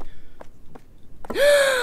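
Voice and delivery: sharp high gasp; loud shocked gasp; startled gasp. Real, not acted. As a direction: gasp in sudden fright. Startled by car.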